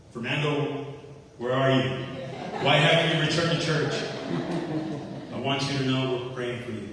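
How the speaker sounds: loud, firm retold scolding; stern. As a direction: imitate a stern pastor calling someone to account. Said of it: "with a very stern voice".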